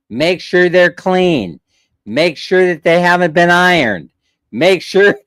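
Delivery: reeling off demands to the clerk, one by one; insistent list. Retold or imitated. A firm checklist, slowed and stressed. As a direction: lean on each make sure.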